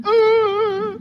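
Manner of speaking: sudden high yelp of alarm; a quick mock shriek of panic; startled gasp. Panic when someone cries.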